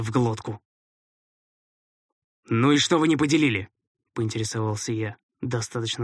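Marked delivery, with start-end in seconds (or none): 2.5-3.7 stern. A narrator's reading. Stern question to fighters.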